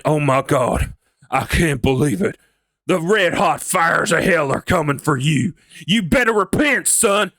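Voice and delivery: hammed-up Texan fire-and-brimstone preacher; deep Texan preacher. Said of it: "you go deeper down".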